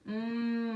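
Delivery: "Hum" as a long "mmm"; isolated M sound. Shown as a demonstration. Teacher showing the M sound, a held "mmm". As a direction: hum with lips closed, no vowel. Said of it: "de hum".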